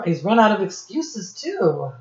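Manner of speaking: ordinary talk, nothing marked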